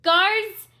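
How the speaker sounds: very loud, high alarm call; queen shouting for the guards; crying out for help. Acted. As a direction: yell for the guards in panic. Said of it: "she cried".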